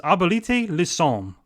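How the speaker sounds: "Abolite le Somme" slowly in an effortful French accent; French title attempt. Shown as a demonstration. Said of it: "I really hope I was close on that pronunciation".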